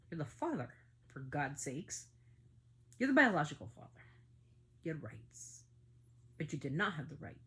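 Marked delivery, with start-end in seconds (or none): 3.0-3.9 much higher and louder, accusing; real furious outburst; angry shout. Real, not acted. Angry rant at the father. 4.8-5.6 whispered, much softer, cold; seething under the breath; bitter whisper. Real, not acted. Angry rant at the father.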